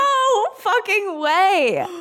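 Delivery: can't believe it's true; stunned disbelief. Real, not acted. Host reacts to Camille sharing her favourite show, stunned.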